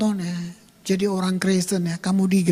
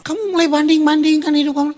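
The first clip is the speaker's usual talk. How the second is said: mocking rebuke; 7 semitones higher, 4 dB louder, faster than usual. Mocking the self-righteous.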